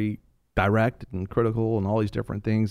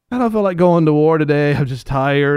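louder, higher whiny excuse; lazy King David; 4 semitones higher, 7 dB louder than usual. Retold or imitated. King David posting he'd rather skip the war, higher whiny moan.

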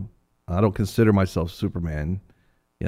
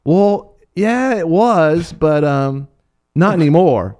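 put-on high, louder child voice, drawn out; eight-year-old girl; 7 semitones higher, 5 dB louder, much slower than usual. Little girl admitting it was her dog, high hesitant voice. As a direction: hedge like a reluctant little kid. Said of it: "this eight-year-old girl says".

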